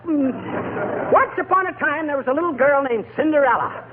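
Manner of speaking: rattled nursery rhyme recital; storytelling voice. Acted. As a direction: hunt for a story without food. Fumbling through nursery rhymes.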